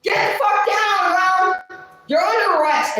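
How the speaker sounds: high, loud barked arrest orders; police shouting. Retold or imitated. Retelling the cops arresting her, loud high shouted orders. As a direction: yell it like cops storming in. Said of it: "And then they said".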